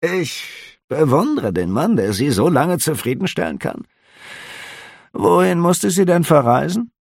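high, curious innkeeper; a nosy innkeeper asking lightly; innkeeper's voice. A narrator's reading. Innkeeper questioning the officer, high and curious.

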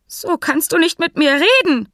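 deutlich höher, empört; ungewohnt hoch. Acted. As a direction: Stimme rutscht vor Kränkung nach oben. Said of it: "Stimme klang ungewohnt hoch". (Hans protestiert mit hoher Stimme gegen Fink)

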